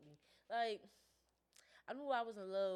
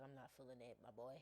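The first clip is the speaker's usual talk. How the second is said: low mock stern; 9 semitones lower, 15 dB quieter than usual. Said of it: "I be like".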